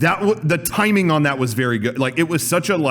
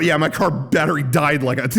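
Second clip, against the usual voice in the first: ordinary talk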